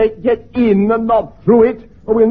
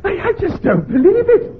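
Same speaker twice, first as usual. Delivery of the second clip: laughing disbelief; 7 semitones higher than usual. Acted. Incredulous at the admiral's request.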